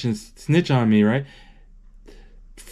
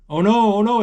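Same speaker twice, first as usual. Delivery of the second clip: higher, excited oh no as it falls; alarmed oh no; 8 semitones higher than usual. Retold or imitated. Kid retelling the movie, alarmed as the cheese falls. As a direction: gasp it out like it's happening now.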